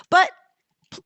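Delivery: ordinary talk, nothing marked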